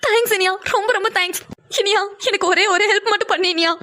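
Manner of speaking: put-on tearful thanks from the older sister; pleading sister. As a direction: ask for one favour, near tears. Pavi thanks and begs.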